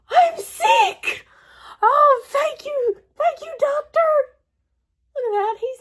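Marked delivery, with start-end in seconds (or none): 0.1-4.1 high, loud joyful cry; delighted. Acted. Gerald thanks Doctor Cat, high overjoyed cry. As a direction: burst out with joy.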